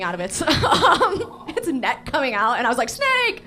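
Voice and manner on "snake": high-pitched yelp of alarm; mock panicked cry. Diver mistaking a sea turtle for danger, mock panicked cry. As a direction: squeal it in comic panic.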